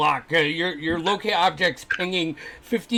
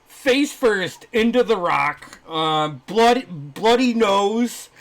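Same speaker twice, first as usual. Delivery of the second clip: normal talking voice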